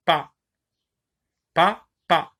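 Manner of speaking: pas, pas split apart; syllable by syllable. Shown as a demonstration. Teacher splitting syllables for the class. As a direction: say "pas, pas" as two separate beats. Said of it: "lorsque je dis pas".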